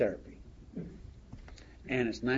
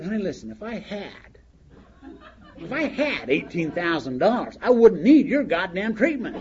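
frustrated outburst; 4 semitones higher, 6 dB louder than usual. Retold or imitated. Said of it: "I was so frustrated".

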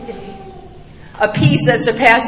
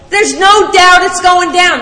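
intense; 6 semitones higher, 6 dB louder, slower than usual. Real, not acted. Climax of plane crash image.

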